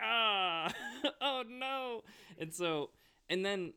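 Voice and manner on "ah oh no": high, loud mock-dismayed wail through laughter; imitated dismay. Speaker recalling relatives' disappointed silence, mock dismay. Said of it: "that disappointment silence, and you're like".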